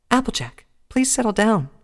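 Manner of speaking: high, gentle pleading pony voice; imploring Fluttershy. Acted. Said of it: "Fluttershy implored".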